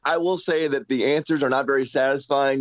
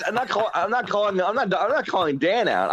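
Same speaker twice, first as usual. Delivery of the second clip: laughing denial; 6 semitones higher than usual. Real, not acted.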